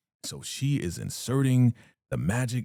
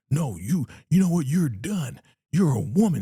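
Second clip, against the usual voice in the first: mock outburst; 7 semitones higher, 3 dB louder than usual. Leader snapping at Ruth.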